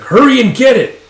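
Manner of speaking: high, full shouted order; acted furious shout; angry yell. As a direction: lose patience and yell the command. Order repeated in anger.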